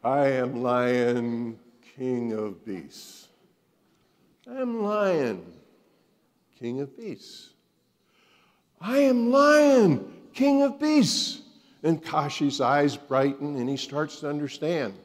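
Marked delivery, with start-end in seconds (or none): 0.0-11.4 slow, growing louder and higher to a roar; building roar. Acted. Lion told to mean it, building to a roar. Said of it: "Say it like you mean it".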